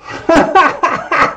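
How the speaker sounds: sudden high burst of real laughter; giggling mid-reading. Real, not acted. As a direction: break into a helpless high laugh. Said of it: "I don't know why I'm laughing so much".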